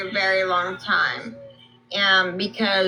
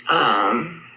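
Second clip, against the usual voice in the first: words muffled by gauze; gauze in mouth; 7 semitones lower than usual. Real, not acted. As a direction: talk through a mouthful of gauze. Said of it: "I have gauze in my mouth".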